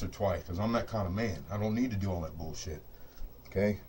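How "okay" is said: normal talking voice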